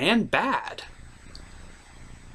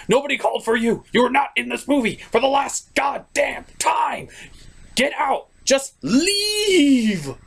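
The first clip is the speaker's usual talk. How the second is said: higher, yelling him out; annoyed shout; 7 semitones higher than usual. Acted. Reviewer yells at Discord to get out of the movie. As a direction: shout the intruder off the show.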